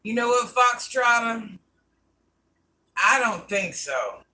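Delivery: slow, drawn-out no; slow dismissive. Real, not acted. Turning down Foxtrotter, slow dismissive refusal. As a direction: stretch out a sassy refusal.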